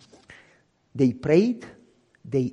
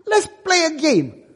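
higher, slower, coaxing insistence; insistent repeat; 10 semitones higher, 5 dB louder than usual. Retold or imitated. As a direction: say it again, slowly and pushing.

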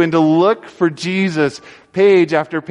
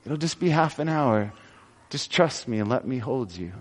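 softer, hushed reassurance, sinking lower; soft fatherly comfort; 3 semitones lower, 8 dB quieter than usual. Pretend. Father comforting his hungry little girl, hushed and tender. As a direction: soothe a hungry child in your arms.

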